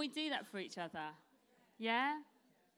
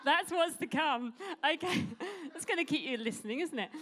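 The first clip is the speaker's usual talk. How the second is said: laughing; 7 semitones higher, 9 dB louder than usual. Real, not acted. Still laughing at slide.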